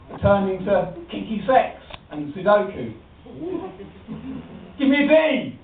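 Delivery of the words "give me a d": cheerleader call. Acted.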